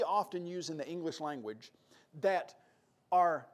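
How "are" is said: ordinary talk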